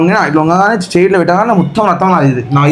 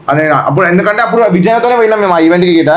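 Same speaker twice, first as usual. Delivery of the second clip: usual speaking voice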